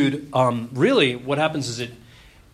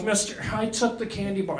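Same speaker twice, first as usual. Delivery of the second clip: put-on sheepish kid confession; 6 semitones higher, 5 dB quieter than usual. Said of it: "be like".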